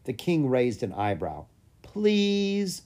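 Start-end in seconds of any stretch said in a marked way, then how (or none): 1.8-2.8 high, drawn-out pleading please; wheedling, stretched-out plea; begging. A narrator's reading. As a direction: plead like a hopeful child. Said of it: "Lucas begged".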